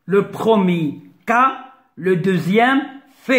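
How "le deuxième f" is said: ordinary delivery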